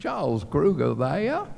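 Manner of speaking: imitating Mrs. Kruger. Mrs. Kruger calling the camp again, insistent mimicry. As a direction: mimic a mother who keeps phoning. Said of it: "In five, ten, fifteen minutes".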